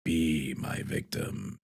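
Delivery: low, slow, hushed horror villain; drawn out softly, ethereal and scary; Candyman. Acted. Streamer quotes Tony Todd's famous horror line, low eerie voice. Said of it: "as the Candyman".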